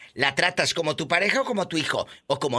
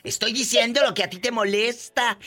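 mocking husbands; 7 semitones higher than usual. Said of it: "Muchos señores que van a escuchar".

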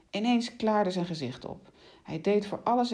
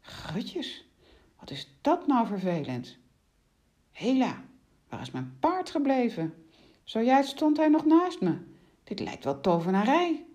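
lightly voiced rider, puzzled and higher; a touch of bewildered annoyance, pitched up; baffled rider; 6 semitones higher than usual. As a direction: fret mildly over something inexplicable.

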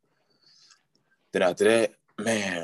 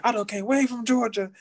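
high-pitched indignant outburst, retold; going off. Patient to nurse about coming from Georgia, going off. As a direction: re-enact ranting far above your usual pitch. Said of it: "I was going off".

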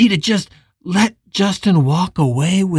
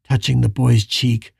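ordinary delivery

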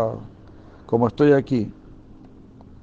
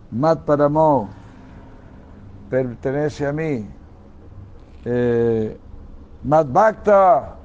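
higher, louder declaiming of the sacred verse; preaching at full force; 3 semitones higher, 5 dB louder, slower than usual. Acted. Preacher reciting Krishna's words to listeners, loud and high. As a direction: thunder the verse out to the congregation.

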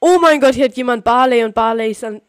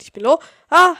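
alarmed shout; 7 semitones higher, 11 dB louder than usual. Real, not acted. Repeats low health, alarmed.

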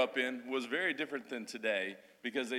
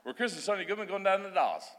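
a thick Aussie accent, higher and bolder; broad Australian; 7 semitones higher than usual. Shown as a demonstration. Husband greets the church in broad Australian before his wife translates. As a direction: say it like an outback local. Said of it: "I'm gonna speak the old, uh, old Australian".

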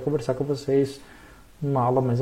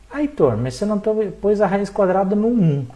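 objecting student; 5 semitones higher, 4 dB louder than usual. Acted.

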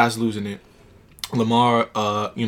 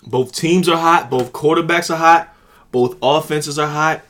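excited; 5 semitones higher, 5 dB louder than usual. Real, not acted. Hyping up the big matchup.

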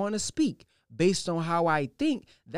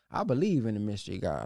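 mock doubter; 9 semitones lower, faster than usual. Imitating a doubter who waits on a mystery God. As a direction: mumble it like a lazy doubter. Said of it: "For those who say".

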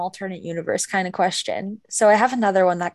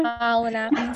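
higher-pitched Southern accent imitation; imitated Southern accent, pitched up; 4 semitones higher than usual. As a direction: put on a Forrest Gumpy Southern accent. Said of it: "that kind of Southern accent".

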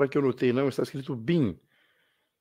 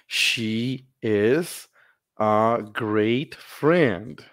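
very slow, careful English; slow model for repeating; slow teacher demo; much slower than usual. Shown as a demonstration. (repetição lenta)

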